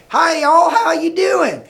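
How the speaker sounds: high, loud Southern drawl greeting; Southern accent. Shown as a demonstration. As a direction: imitate a friendly Southerner calling out. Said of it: "Some people down south".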